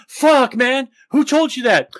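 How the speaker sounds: mock panic. Imitating Aiden hearing he'll go to jail, hammed-up panic. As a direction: jump up in fake alarm. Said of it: "And he just jumps up".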